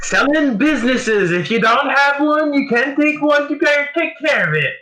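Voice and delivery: very high, loud squeaky merchant voice. Acted. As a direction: pitch way up like a tiny shopkeeper.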